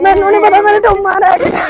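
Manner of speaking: loud wailing complaint; a loud, tearful village lament. Acted.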